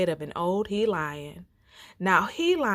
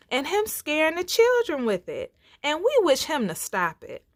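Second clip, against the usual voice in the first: little animals pleading; 7 semitones higher than usual. Acted.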